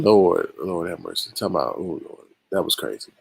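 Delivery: ordinary talk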